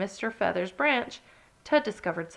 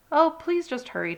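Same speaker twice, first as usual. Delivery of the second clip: a touch higher impatient plea; mild squeal; 7 semitones higher, 3 dB louder than usual. Acted. Fussy Squirrel hurrying Ted, mildly high and impatient. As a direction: lightly squeal it, fussing. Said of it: "squealed Fussy Squirrel".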